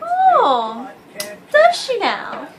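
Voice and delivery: sarcastic, drawn-out disbelief. Retold or imitated. Catching a friend in a lie, sarcastic.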